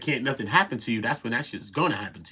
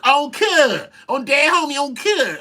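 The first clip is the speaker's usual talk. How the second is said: mocking; 8 dB louder, slower than usual. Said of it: "I won't be saying".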